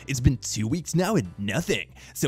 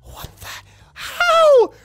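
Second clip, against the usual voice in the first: disbelief; 11 dB louder, much slower than usual. Retold or imitated. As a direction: drop then yelp out how. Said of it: "I'm like".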